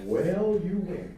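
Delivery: laughing concession. Retold or imitated. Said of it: "And I said".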